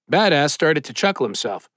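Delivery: ordinary delivery, nothing marked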